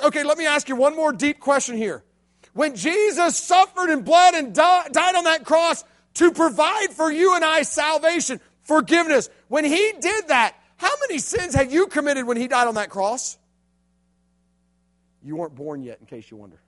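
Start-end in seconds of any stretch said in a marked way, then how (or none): 2.5-13.4 1 earnest preaching. Acted. Preaching climax.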